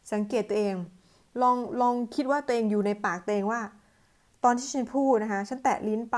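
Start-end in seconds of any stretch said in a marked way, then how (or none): none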